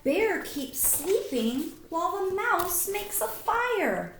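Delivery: ordinary delivery, nothing marked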